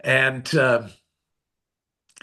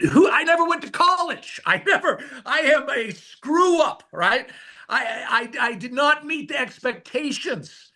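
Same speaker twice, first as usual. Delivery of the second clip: much higher, laughing through the self-put-down; mock your own failure with a laugh; laughing self-mockery; 11 semitones higher than usual. Telling dad he's a screw-up, high laughing self-mockery.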